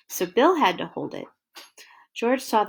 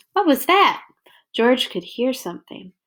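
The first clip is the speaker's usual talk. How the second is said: ordinary delivery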